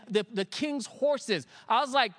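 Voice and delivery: usual speaking voice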